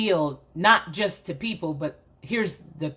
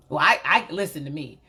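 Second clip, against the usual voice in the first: normal talking voice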